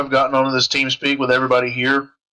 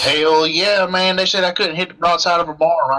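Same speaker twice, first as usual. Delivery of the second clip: mock hillbilly yarn, light; southern accent; 5 semitones higher than usual. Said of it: "southern accent".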